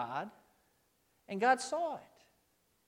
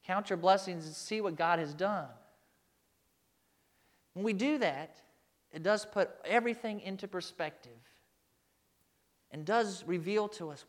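ordinary talk

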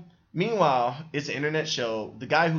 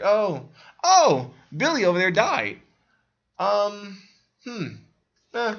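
high, louder breezy voice for an unbothered bystander; mock casual onlooker; 7 semitones higher, 6 dB louder, slower than usual. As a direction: toss off the death like nothing happened. Mocking oblivious onlookers.

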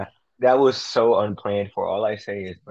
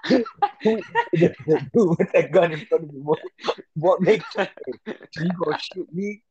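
high-pitched, laughing retelling; real giggles breaking up the words; 9 semitones higher than usual. Real, not acted. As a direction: struggle to talk through laughter. Cracking up while retelling the scene.

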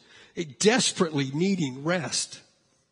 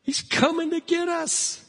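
mock screaming; 10 semitones higher than usual. Disciples in the boat as the figure nears, mock screaming. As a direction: imitate a frightened cry in a raised pitch. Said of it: "In the middle of their screams".

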